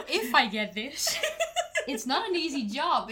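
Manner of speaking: loud laughing, high pitched. Real, not acted. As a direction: giggle through the words. Laughs about the hard job.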